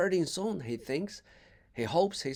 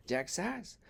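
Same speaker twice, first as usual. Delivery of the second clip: plain reading voice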